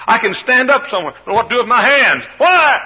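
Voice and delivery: high, loud shouted preaching; full-force preaching. Real, not acted. To the congregation, a loud, high preaching climax. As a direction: hammer each why home.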